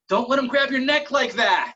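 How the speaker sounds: high, loud urgent yell; friend's yell. Retold or imitated. Friend yelling advice to cage fighter mid-fight, urgent yell. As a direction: yell it out like a panicking cornerman. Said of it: "my friend yells out".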